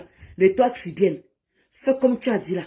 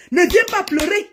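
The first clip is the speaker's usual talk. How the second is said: raised heated repeat; angry threat repeated; 8 semitones higher than usual. Real, not acted. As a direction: hammer the warning home. Threatening rival.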